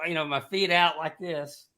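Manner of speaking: ordinary talk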